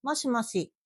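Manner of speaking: ordinary delivery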